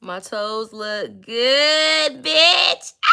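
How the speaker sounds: slow then a loud high shouted bitch; boastful shout. Acted. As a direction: belt it out in triumph. Showing off, shouting.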